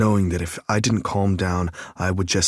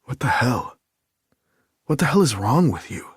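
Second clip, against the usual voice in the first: stunned disbelief; 6 semitones higher than usual. A narrator's reading. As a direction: blurt it out, floored. Nick floored, exclaims.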